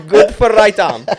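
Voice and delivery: loud, high, drawn out through laughter; real laughter. Real, not acted. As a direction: crack up while saying it. Cracking up at an innuendo.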